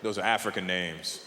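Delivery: low, soft impression of a friend; low-voiced imitation of a dad explaining; friend, proud father. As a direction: drop deep and quiet as the friend. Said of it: "He goes".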